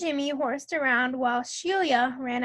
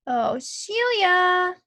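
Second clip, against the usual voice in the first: drawn-out, higher, exasperated call; half-shouted in narration; mild shout; 5 semitones higher, 4 dB louder, much slower than usual. A narrator's reading. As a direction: say it slowly, pitched up, as a restrained shout. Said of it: "I shouted".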